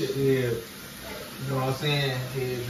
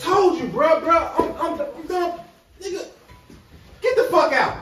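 high, loud furious yelling; angry yelling; 6 dB louder than usual. Acted. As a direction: scream at him for the money. Friend yelling, throwing him out.

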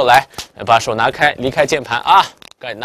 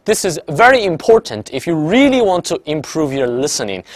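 slow, deliberate English for students to repeat; slow shadowing read; 3 semitones higher, slower than usual. Shown as a demonstration. Teacher reads English slowly so students can shadow, slow and deliberate. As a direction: pace each phrase so they can echo. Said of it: "但你比我慢个半拍".

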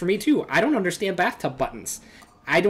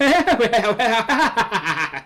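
long, higher-pitched burst of laughter; hearty laugh; 4 semitones higher, 4 dB louder than usual. Real, not acted. Player amused by the pig mascot idea, hearty laughing.

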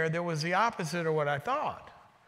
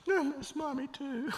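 high, whimpering mock sob; imitated tearful crying; 8 semitones higher, 3 dB quieter than usual. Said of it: "about a minute of her sobbing".

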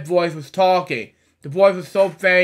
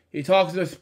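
plain reading voice

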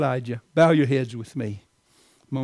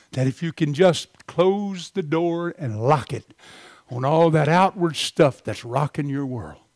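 ordinary talk

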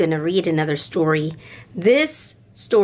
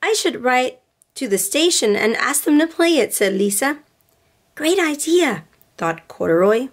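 gentle storybook read-aloud with voices; storybook reading for little girls; reading to children; 4 semitones higher than usual. A narrator's reading. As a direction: read it like bedtime story time. Reads picture book to girls.

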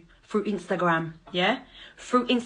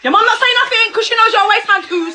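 loud, high-pitched insult; angry jeer; 10 semitones higher, 7 dB louder than usual. Real, not acted. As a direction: fire back with raised voice. Hurling insult back at ex.

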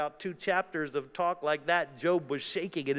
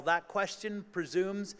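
ordinary talk, nothing marked

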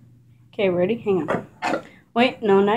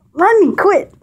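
much higher, much louder shout at the dog; yelling at the dog to quit; 10 semitones higher, 7 dB louder, slower than usual. Real, not acted. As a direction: shout his name to stop him.

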